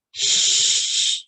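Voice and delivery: whispered shush. A narrator's reading. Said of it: "Frog didn't make a sound".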